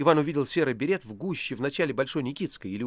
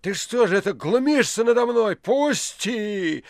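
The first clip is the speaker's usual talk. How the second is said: loud, high, slowed outraged accusation; flaring anger; 9 semitones higher, 6 dB louder, slower than usual. A narrator's reading. Poet turning on the precentor in a fury. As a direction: explode at him in rage. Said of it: "прокричал Иван".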